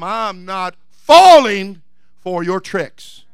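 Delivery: slow, with "falling" stretched out loud; defiant declaration. Acted. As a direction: lean hard on "falling", then settle on "for your tricks". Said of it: "And you tell him".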